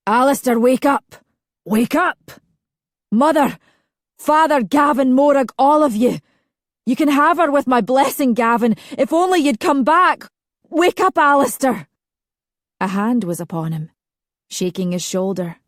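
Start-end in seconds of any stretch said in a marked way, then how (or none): 0.1-11.9 high, loud frantic calls. Acted. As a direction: call out in desperate panic. Frantic cries in dream.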